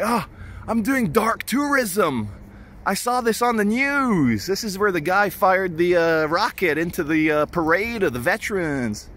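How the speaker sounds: fake American accent. Vlogger bluffing to the police officer in a fake American accent. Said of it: "I put on my best fake American accent".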